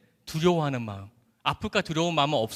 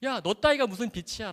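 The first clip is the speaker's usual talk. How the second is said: higher, softer belittling voice; evil inner voice; 5 semitones higher, 4 dB quieter than usual. Retold or imitated. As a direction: imitate a discouraging tempter, putting the listener down. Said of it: "나쁜 음성은 포기하게 만듭니다".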